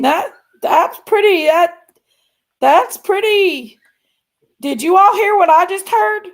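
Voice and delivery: loud, high-pitched astonished reaction; startled disbelief. Real, not acted. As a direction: ask listeners in real shock, voice jumping up. Reacting to what she just heard.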